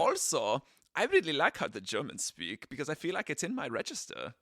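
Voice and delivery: bouncy mock German lilt; sing-song German accent. Shown as a demonstration. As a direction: bounce the words along like a playful German. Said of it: "a little sing-song bouncy bounce".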